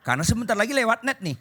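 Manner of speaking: normal talking voice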